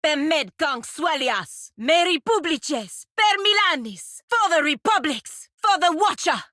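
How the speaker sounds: rally the troops with a war cry; battle cry. Acted.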